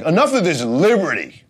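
high, loud scoffing voice; mock liberty opponent. Comic mocks imagined liberty opponent, high, loud. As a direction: ham up an absurd villain. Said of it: "Who's like".

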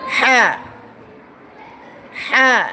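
হ্যাঁ pushed out loud and firm from the throat; forceful throat letter. Shown as a demonstration. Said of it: "শক্ত করে বলতে হবে".

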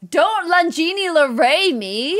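slow, drawn-out mock indignation; mock offended. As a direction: pretend to be scandalized. Mock offense at Sim gibberish.

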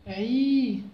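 عي stretched long and firm; عي drawn out clearly; long vowel demo. Shown as a demonstration. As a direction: model عي for the class. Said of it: "حيولي عي".

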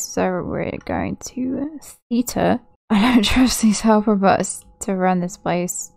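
ordinary delivery

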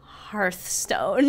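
whispered dramatic reveal; whispering. Pretend. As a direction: breathe the name like a secret.

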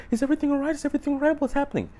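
high mock-panicked girlfriend in the car; scared girl voice. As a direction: mimic a frightened girlfriend. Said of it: "The girl's, like, super scared".

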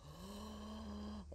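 sudden shocked intake of breath; sharp gasp. Acted. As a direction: gasp in alarm. Dot gasps in panic.

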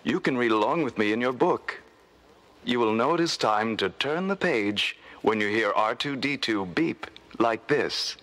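ordinary delivery

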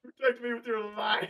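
mock dramatic plea.

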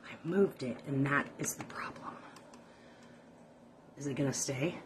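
whispered aside to herself; soft whispered mutter. Real, not acted. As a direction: whisper it under your breath.

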